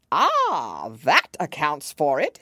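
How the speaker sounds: loud Hatter, satisfied with himself; knowing Hatter. A narrator's reading. Hatter explaining Time to Alice, knowing. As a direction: pronounce it loudly, as if all is explained.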